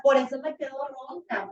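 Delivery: normal talking voice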